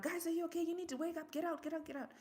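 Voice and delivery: calling out. Retold or imitated. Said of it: "and calling out".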